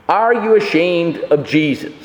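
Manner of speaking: ordinary talk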